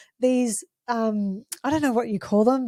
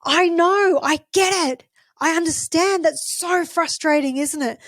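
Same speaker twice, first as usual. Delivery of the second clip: put-on higher and louder, matching an angry child's frustration; matching their intensity; 6 semitones higher, 3 dB louder than usual. Shown as a demonstration. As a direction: meet the tantrum at full energy, but agreeing. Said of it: "match the volume and the tone".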